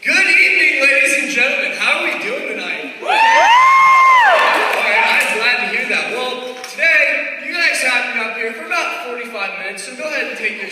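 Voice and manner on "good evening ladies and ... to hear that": high, loud showman hello; loud crowd greeting. Acted. Comic greeting the audience, shouting high and loud. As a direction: work the room with a big shout.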